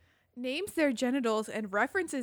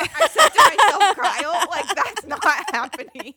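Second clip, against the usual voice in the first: loud disbelief; 6 semitones higher, 13 dB louder than usual. Real, not acted. Talks over friends, laughing disbelief.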